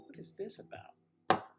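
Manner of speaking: puzzled. Retold or imitated. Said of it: "and I'm like".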